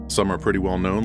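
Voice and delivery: ordinary delivery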